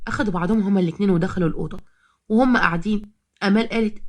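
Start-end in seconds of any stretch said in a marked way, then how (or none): none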